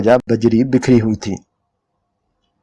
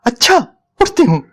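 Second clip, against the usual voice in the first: shouting; 13 semitones higher, faster than usual. A narrator's reading. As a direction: fling the words out in a rage. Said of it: "और चिल्लाई".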